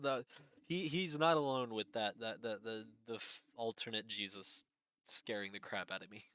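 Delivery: ordinary delivery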